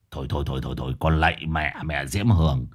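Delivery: low, soft run of weary protest; exasperated pleading. Acted. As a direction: cut her off with weary mock begging. Exasperated master begs her to stop. (giọng trầm, ngán ngẩm van xin)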